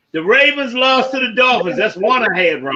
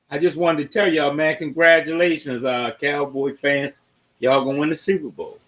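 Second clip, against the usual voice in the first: mock congratulations; 7 semitones lower, 4 dB quieter than usual. Mock congratulations to Cowboy fans.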